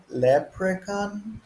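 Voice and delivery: leprechaun, slow and pitched high; slow model pronunciation. Shown as a demonstration. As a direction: sound out leprechaun for learners to repeat. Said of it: "Can you say that word?".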